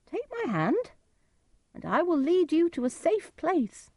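very high put-on voice of a stranger; disguised stranger's voice. A narrator's reading. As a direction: call out high, pretending to be somebody else. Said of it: "Edgar used a different voice".

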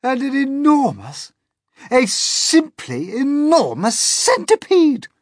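very high, loud scream; panicked captain screams about the centipede. A narrator's reading. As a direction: scream it in wild disbelief. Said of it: "screamed the captain".

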